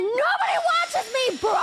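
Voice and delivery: high mock yell; hammed-up outburst; mock crash-out yell. Streamer retells her online meltdown, high mock yell. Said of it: "I literally said piss".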